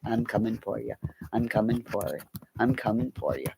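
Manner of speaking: low, soft creepy spider threat, just a hint of menace; lightly voiced spider. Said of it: "This is the spider going after Benny Keys".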